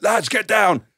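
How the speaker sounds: high, loud urgent yell; shouted warning. Retold or imitated. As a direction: call out to the lads to duck. Acted warning shout to teammates.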